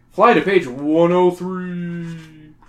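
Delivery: slow, grand announcer read; dramatic announcer. Acted. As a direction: stretch it out like a show host. Dramatic page instruction.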